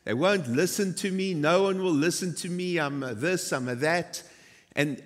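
ordinary talk, nothing marked

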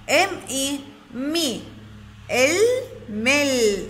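ام، اي، مي built up slowly towards ملح; letter sounds first, then blended, with pauses; sounding out. Shown as a demonstration. Teacher breaking up ملح for children, sound by sound. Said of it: "نقطع هاي الكلمة".

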